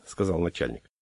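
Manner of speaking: ordinary delivery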